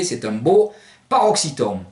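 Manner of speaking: ordinary talk, nothing marked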